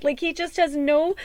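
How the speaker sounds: ordinary talk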